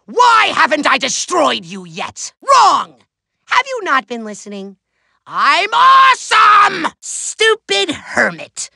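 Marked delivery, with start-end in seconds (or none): 2.4-3.0 loud, one-word villain outburst; snapped correction. Acted. Villain corrects his foe. 5.3-6.9 high, drawn-out self-praise; boastful yell. Acted. Villain boasts of himself.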